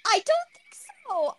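high, loud teasing disbelief; incredulous. Acted. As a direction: scoff at the idea.

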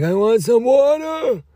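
very high, slow, whimpering plea; squeaky exaggerated whine; whiny high voice. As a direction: beg for water in a tiny squeaky voice. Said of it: "And you're gonna be like".

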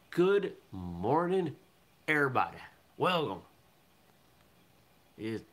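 put-on Southern-New England accent. Host opens the morning show, in a put-on Southern-New England accent. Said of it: "More or less Southern, but there's some".

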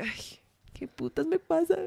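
soft, slow, high and dismayed; agobiada y bajito. Retold or imitated. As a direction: breathe it out, high and flustered. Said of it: "yo era como re". (agobiada, lenta y aguda)

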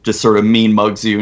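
ordinary delivery

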